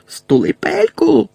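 high, drawn-out yell; shrieked order to shut up. A narrator's reading. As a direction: cut them off with a shriek. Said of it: "крикнула Белатриса".

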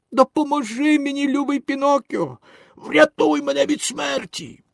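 high, pleading wail; desperate wailing. A narrator's reading. Drowning dog begs the boy to save him, desperate wailing. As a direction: beg for your life in a high cry. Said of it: "Почувши розпачливі зойки".

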